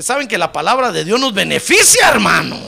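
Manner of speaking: loud preaching that bursts into a shout; emphatic preaching. Real, not acted. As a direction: drive it home to the congregation. (prédica enfática que termina en grito)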